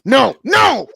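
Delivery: high, loud no no; yelled refusal. Acted. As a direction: shout it off. Refusing in story.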